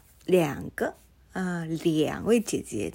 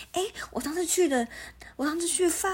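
chatty coworker voice; 10 semitones higher, faster than usual. Retold or imitated.

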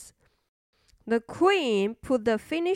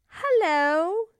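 cheerful friend; 6 semitones higher than usual. Acted. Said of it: "said her best friend".

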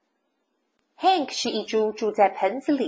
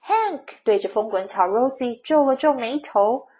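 ordinary delivery

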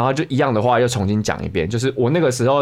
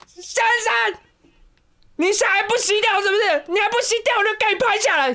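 much higher mock yell of a street stranger; crazy woman yelling. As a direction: squeal it way up like the unhinged lady scolding.

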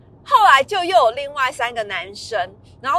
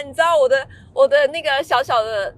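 ordinary talk

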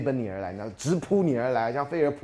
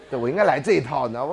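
ordinary talk, nothing marked

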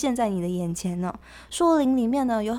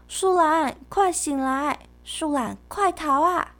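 calling out; 7 semitones higher, 4 dB louder, slower than usual. Acted. Urging the sleeping sloth.

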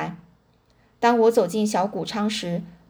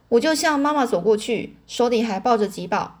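plain reading voice

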